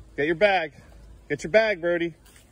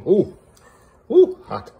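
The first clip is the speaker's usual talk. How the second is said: ooh hot; 11 semitones higher, 10 dB louder, much slower than usual. Real, not acted. Touching hot pancake.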